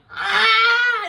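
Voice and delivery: loud high-pitched squeal; loud high squeal at being asked for her number; high excited squeal. Retold or imitated. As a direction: squeal like a flustered fan. Squeals when he asks her number.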